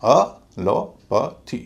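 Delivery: Allopathy drawn out very slowly, syllable by syllable, a-lo-pa-thee; slow model pronunciation. Shown as a demonstration. Teacher slowly spelling out the word for students, very slow. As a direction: stretch out allopathy, a short a, then a heavy lo, then a light pa. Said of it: "पूरे शब्द का उच्चारण होगा".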